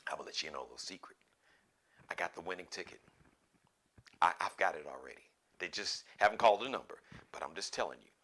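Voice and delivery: low, hushed secret-sharing voice; confiding a secret. Pretend. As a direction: drop way down and confide it quickly, very softly.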